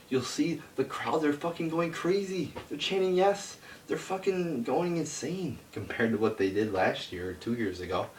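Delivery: fired up, voice climbing high as the rant builds; heated rant. Real, not acted. Ranting about crowd going crazy.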